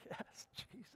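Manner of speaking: soft awed praise; breathy worship whisper. Retold or imitated. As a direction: breathe it out in wonder. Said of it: "natural it will be to go".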